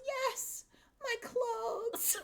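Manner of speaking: put-on high toddler voice breaking into a laugh. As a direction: squeak it like a tiny kid, laughing. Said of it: "she goes".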